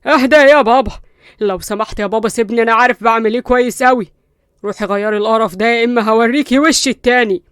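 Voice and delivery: loud, heated yelling; angry shout. Acted. Qusay shaking off his father, shouting in anger. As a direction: brush father off and threaten her.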